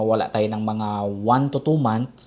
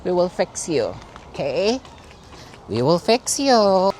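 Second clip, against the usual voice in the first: higher, lilting pet talk; cooing reassurance; 5 semitones higher than usual. Real, not acted. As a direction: soothe the cat, drawing out the last promise. Reassuring cat at the hospital.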